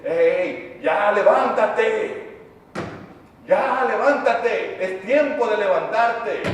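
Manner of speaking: rousing preacher voicing God's command; urgent wake-up call. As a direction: call out firmly, drawing out the words. God calling people to rise.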